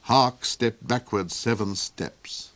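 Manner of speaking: ordinary delivery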